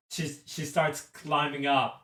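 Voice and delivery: ordinary talk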